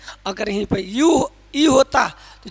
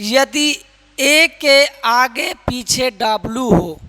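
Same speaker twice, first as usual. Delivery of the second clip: slow rule read-out; 5 dB louder, much slower than usual. Real, not acted. Teacher slows for rule.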